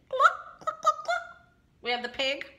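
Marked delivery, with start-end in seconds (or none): 0.1-1.5 very high hen clucks; hen clucking. Acted. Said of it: "We have the hen".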